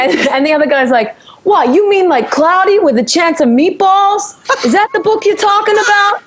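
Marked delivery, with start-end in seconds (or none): 1.4-6.2 put-on high-pitched Italian American accent, incredulous question; loud Italian American. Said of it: "they were so loud ... so Italian American".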